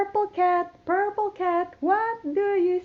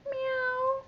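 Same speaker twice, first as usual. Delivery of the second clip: cat meow; 8 semitones higher, 8 dB quieter than usual. Shown as a demonstration. Cat recap.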